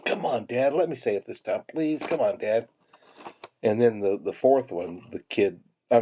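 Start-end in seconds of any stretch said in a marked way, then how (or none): 0.1-2.7 higher-pitched begging little cactus; insistent pleading kid. Retold or imitated. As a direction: imitate a child pestering Dad, pitched up. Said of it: "and the little cactus was still".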